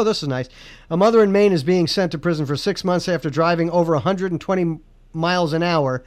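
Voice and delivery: ordinary delivery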